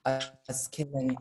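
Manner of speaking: normal talking voice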